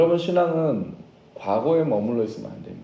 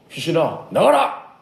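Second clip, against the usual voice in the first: sharp, raised exorcism cry; commanding shout; 4 semitones higher, 5 dB louder, slower than usual. Acted. Disciples ordering a demon out of a child, commanding shout.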